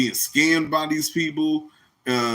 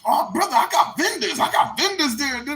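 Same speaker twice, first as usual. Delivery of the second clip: mocking preacher; 10 semitones higher than usual. Mocking a preacher selling debates.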